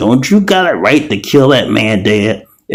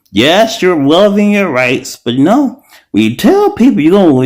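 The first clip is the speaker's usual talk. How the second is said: imagined moderator; 7 semitones higher, 3 dB louder than usual. Pretend. Imagined TikTok moderator voiced high.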